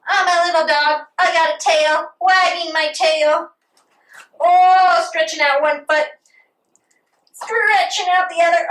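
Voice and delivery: playful dog pose. Acted. Acting as a little dog.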